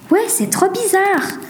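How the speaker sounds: high, drawn-out agreement; animated agreement. Acted. Characters feel strangely watched.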